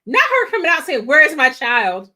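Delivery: loud, fast outburst at the screen; loud exclaiming. Real, not acted. Reacting to the mother in the show, loud and fast. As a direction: blurt it out in disbelief.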